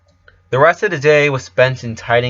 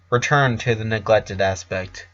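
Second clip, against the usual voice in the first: ordinary delivery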